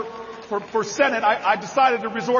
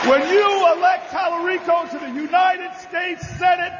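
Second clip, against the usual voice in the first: roaring endorsement once more; rally shout repeated; 6 semitones higher than usual. Acted. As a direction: hammer it home again to the crowd. Repeated over crowd noise.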